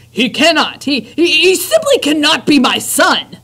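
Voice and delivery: high, loud anguished cry; despairing father. Acted. Lester despairs over his son, high loud cry.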